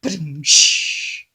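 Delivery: loud, drawn-out drum-and-cymbal imitation; mouthed punchline rimshot; vocal rimshot. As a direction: mimic a comedy drum sting with a long hiss. Imitates a rimshot after the joke.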